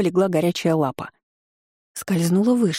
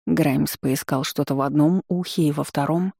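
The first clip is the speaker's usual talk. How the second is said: usual speaking voice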